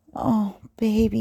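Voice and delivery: ordinary talk, nothing marked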